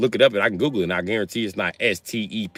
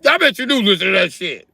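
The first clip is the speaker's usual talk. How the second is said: mock dad scolding; 10 semitones higher, 3 dB louder than usual. Father voiced in argument.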